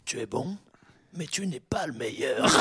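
low, soft, solemn pronouncement; speech to the fighter. Retold or imitated. Trainer giving his gladiator a speech, low and measured. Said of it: "il fait un discours".

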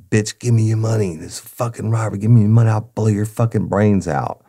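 high, harsh retold scream of a threat; robber screaming. Gunman screaming threats at the store clerk, mock yell. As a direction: bark it at her like the gunman. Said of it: "is being screamed in the face".

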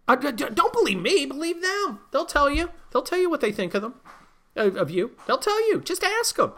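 high mocking whiny voice. Mocking the Democrats to his listeners in a high voice.

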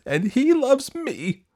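slow, very high shout; drawn-out high cry of defiance. A narrator's reading. Daughter insisting to her parents she is loved, shouting. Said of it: "Dorothy shouted".

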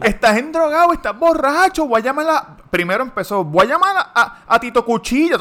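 hammed-up woman screaming accusations; shrieking woman. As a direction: screech it like the furious driver. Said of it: "empieza a gritar".

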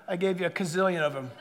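low, quick, offhand; dry comeback. Acted. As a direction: quietly toss off the retort.

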